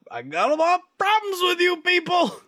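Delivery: over-the-top comic outburst; mock airing of grievances. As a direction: yell it like a ranting dad at the table. Mock Festivus rant.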